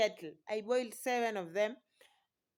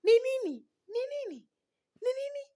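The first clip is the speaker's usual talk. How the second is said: much higher, anxious mother's voice; worried mother asking what happened; worried mom; 14 semitones higher, 5 dB louder, much faster than usual. Retold or imitated. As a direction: plead like a frantic mother. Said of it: "My mom is like".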